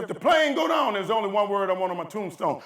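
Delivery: high, loud dramatic declaration, easing off; husband telling his wife about the plane; retold shout. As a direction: proclaim it like a last wish. Husband answers worried wife.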